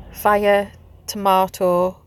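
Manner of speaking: ordinary talk, nothing marked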